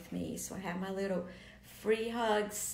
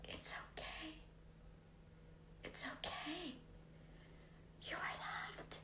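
soft, higher hushed reassurance; soothing whisper; 7 semitones higher, 11 dB quieter than usual. Real, not acted. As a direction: whisper calmly to someone in tears. Comforting a crying man.

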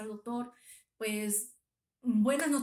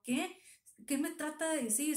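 higher-pitched, shocked questioning, retold; retold tearful fear; 5 semitones higher than usual. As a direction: recount a frightened, disbelieving question. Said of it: "ya me solté llorando con mucho miedo".

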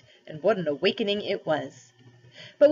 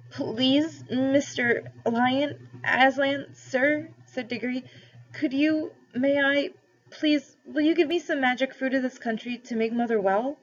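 put-on higher, halting plea of a boy; pleading boy; 7 semitones higher, slower than usual. A narrator's reading. Diggory begging Aslan to heal Mother, higher pleading voice. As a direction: beg the great lion timidly for help.

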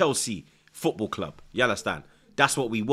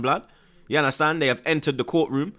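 ordinary delivery

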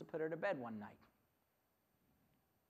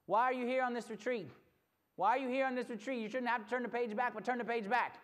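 high, loud, insistent pulpit delivery; forceful preaching; 3 semitones higher, 5 dB louder than usual. Real, not acted. Preacher asking retreat crowd why they came, forceful preaching. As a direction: drive the question home, then proclaim.

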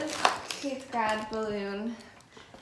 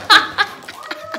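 helium giggle, high and breathy; laughing; 11 semitones higher, 12 dB louder than usual. Real, not acted. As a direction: laugh through the words.

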